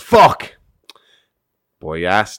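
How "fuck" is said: sharp, high, loud curse; dad cutting himself, a loud high yelp; sudden yell. Retold or imitated. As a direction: yell it as if you just cut your finger. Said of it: "he yells".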